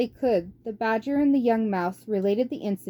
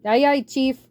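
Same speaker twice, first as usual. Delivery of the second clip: louder, eager lackey's reply; rat henchman; 6 semitones higher, 6 dB louder than usual. Acted. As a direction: snap back a ready yes to the boss. Henchman answers warlord.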